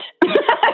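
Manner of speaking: a short burst of breathy laughter. Real, not acted. To the audience after admitting eight cookies, breathy laugh. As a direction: laugh it off, amused at herself.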